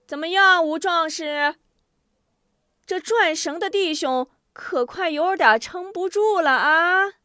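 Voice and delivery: high-pitched villain calling out; taunting, impatient call; impatient urging shout. A narrator's reading. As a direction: press him loudly, dragging up the last word. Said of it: "许仁不耐烦地催喊".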